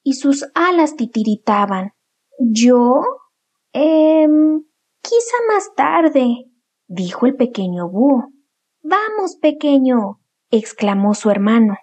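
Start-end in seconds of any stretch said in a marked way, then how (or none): 2.4-6.5 scared little owl. Acted. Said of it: "sus piernas le temblaban". 8.8-10.2 big brother owl. Acted. Brother urges little owl.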